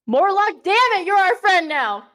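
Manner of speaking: mock-stern loud declaration; emphatic shout. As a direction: raise the voice and declare it forcefully. Repeats it echoing Mortlock's shouted demand.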